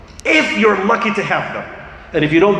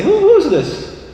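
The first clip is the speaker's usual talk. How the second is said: high, slow, puzzled question; a hint of a curious elderly father; lightly voiced old father; 12 semitones higher, slower than usual. Elderly father asking who the speaker is, slow and high.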